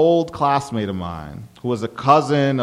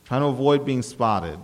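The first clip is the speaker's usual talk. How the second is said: ordinary delivery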